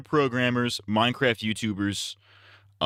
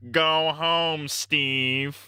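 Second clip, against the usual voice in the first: slightly raised put-on sitcom voice; acted sitcom reply; 5 semitones higher, much slower than usual. Sitcom character sending the nerd home, lightly acted. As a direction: lightly act the sitcom comeback. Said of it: "Steve Urkel gets caught".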